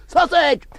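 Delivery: sudden high, loud yelp; sausage shout. Acted. Newsreader breaks off the report with a high sudden shout. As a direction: shriek it mid-bulletin. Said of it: "shout sausage when you occasionally".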